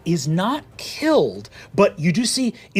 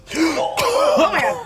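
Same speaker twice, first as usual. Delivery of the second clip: gasp; 13 semitones higher than usual. Acted. GM reacts to Wick falling.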